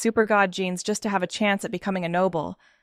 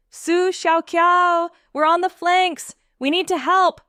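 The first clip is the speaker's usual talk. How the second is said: loud, high call to a teammate; 7 semitones higher, 5 dB louder than usual. Acted. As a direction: call across the fight to rally her. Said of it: "called out".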